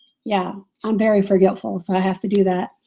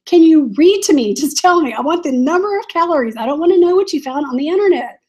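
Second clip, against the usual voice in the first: retold exasperation, pitched up; higher, mildly fed-up mock rant; exasperated retort; 5 semitones higher, 3 dB louder than usual. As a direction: plead crossly with a useless voice assistant. Retelling her exasperation with Siri.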